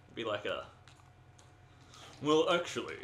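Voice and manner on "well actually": raised, louder put-on English voice; mock-English character. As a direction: play a very English fellow. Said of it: "What kind of voice would he have?".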